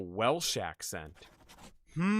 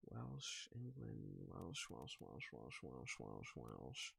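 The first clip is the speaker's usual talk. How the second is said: much softer repeated murmur; muttering to self; 5 semitones lower, 19 dB quieter, much slower than usual. Real, not acted. Streamer pondering a player's origin, soft muttering. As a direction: mumble it under your breath. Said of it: "muttering guesses to self".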